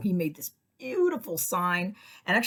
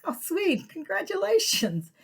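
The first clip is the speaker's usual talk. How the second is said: sugary congrats; 12 semitones higher, 4 dB louder, slower than usual. Retold or imitated. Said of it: "I'm like".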